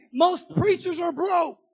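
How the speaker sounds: loud preacher's drive, pushing each phrase; preaching at full force. Acted. Preacher urging the church to give first, preaching hard.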